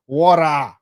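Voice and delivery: "Wara" said loud with a tapped middle and long ending; exaggerated American pronunciation. Shown as a demonstration. Asking for a photography contract, exaggerated American "Wara". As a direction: stretch "Wara" out, no hard t. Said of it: "Accent ya America ni da".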